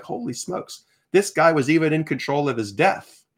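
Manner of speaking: high-pitched awed realization; retold amazement. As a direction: relive the sudden astonishment. Retelling his astonishment at the reading.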